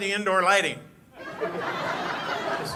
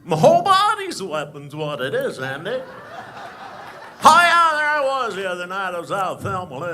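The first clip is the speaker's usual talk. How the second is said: Barney Fife impression; 3 semitones higher, 5 dB louder than usual. Comic plays the boastful deputy telling a crowd a story, loud and squeaky. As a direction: brag like a nervous, puffed-up small-town deputy. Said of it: "Ladies and gentlemen, Barney Fife".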